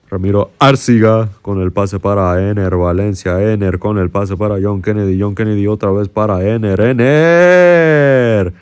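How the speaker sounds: commentator calling the build-up; Spanish play-by-play. Acted. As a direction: narrate the attack like a TV commentator.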